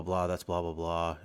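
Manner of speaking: usual speaking voice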